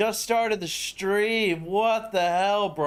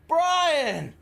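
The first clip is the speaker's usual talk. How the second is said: high call of the name; excited call; 9 semitones higher than usual. Real, not acted. Streamer calling out the donor by name, high and excited. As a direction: call him out in delight.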